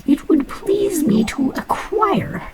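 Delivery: slow, breathy whisper begging for the iron ore; pleading whisper. Acted. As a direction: coax it out in a hushed whisper.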